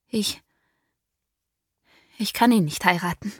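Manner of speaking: fast, stammered start then rushed words; said quickly. Acted. Daughter blurting her refusal as father steps close, hurried. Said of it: "sagte ich schnell".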